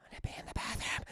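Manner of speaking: breathy, quick, hushed whisper; teasing put-on whisper; mock sultry whisper.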